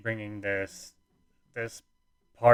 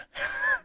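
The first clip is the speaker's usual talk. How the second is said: breathy laugh. Real, not acted. Laughs after telling greeting.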